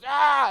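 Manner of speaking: short, high, loud shriek; startled acted scream; imitated grandma's scream. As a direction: shriek in mock horror.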